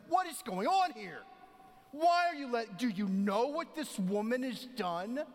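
loud, high, outraged accusation; indignant Pharisees. Retold or imitated. Pharisees confronting Jesus, loud and outraged. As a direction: demand answers like scandalized religious leaders. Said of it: "the Pharisees like".

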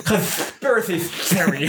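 slow lisping cartoon duck; Daffy Duck voice. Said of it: "Good Daffy Duck impression".

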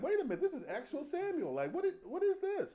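high, startled witch voice; hammed-up shocked witch. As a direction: gasp it out like she can't believe it. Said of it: "She was like".

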